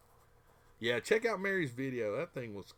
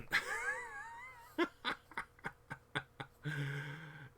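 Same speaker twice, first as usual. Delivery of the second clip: high laugh. Real, not acted. Laughs at frustration.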